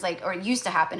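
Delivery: usual speaking voice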